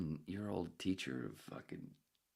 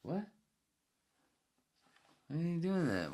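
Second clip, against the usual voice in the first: put-on kids' puzzled voice; 10 semitones higher, 4 dB louder than usual. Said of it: "the kids are going".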